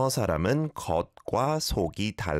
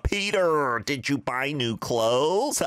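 exaggerated American accent, higher and drawn out; 6 semitones higher, slower than usual. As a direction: ham up a clumsy American accent. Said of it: "terrible with my American accents".

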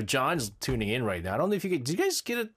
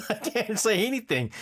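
laughing through the words, higher; 7 semitones higher than usual. Shown as a demonstration. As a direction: giggle it out.

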